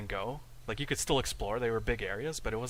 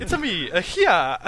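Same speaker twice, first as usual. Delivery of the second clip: put-on high, loud Mario-style Italian accent; cartoon Italian plumber voice; 8 semitones higher, 10 dB louder than usual. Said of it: "how do you even do that in an Italian accent".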